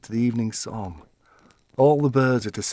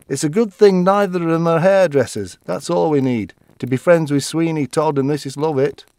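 louder, higher chatty neighbour gossip; gossipy wife; 5 semitones higher, 7 dB louder than usual. Retold or imitated. Late wife gossiping to her husband about the neighbours, chatty and loud. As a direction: dish the dirt with relish.

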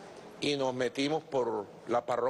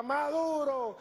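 high, drawn-out call; high-pitched mock yell; retold cry; 12 semitones higher, much slower than usual. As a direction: imitate a supporter crying out a name. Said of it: "Se para y grita".

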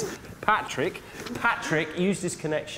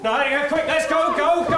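being chased; 8 semitones higher, 4 dB louder than usual. Acted. Fleeing the butchers' shop.

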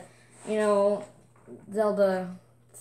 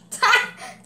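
burst of laughter; 9 dB louder than usual. Real, not acted. Amused at the game.

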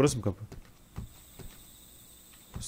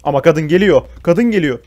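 alarmed; 7 dB louder than usual. Real, not acted. Woman chasing him in game.

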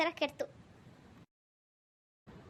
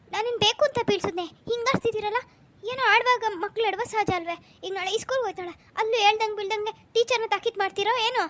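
raised, louder, indignant retort; angry shouting back; 5 semitones higher, 4 dB louder than usual. Acted. Wife shouts back at her husband, angry and louder. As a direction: hurl each question back at him, higher and louder.

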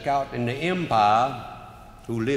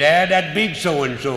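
raised voice, slow and scornful; mock insult; 4 semitones higher, 7 dB louder, slower than usual. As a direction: sneer it up at the batter. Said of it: "He said".

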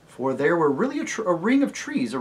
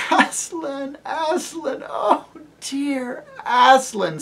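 high, slow, near tears of joy; high tearful cry of joy; joyful sobbing; 11 semitones higher, 5 dB louder, much slower than usual. Acted. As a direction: cry out her name, overwhelmed with relief. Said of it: "sobbed Lucy".

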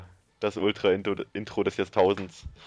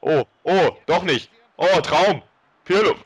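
loud, high excited gasps; 8 semitones higher, 6 dB louder, slower than usual. Real, not acted. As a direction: react thrilled to a chance. Attack building in match.

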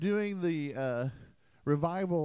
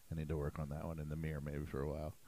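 ordinary delivery

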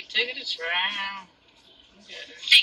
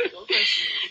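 laughing; 14 semitones higher than usual. Real, not acted. Friend teasing, laughing.

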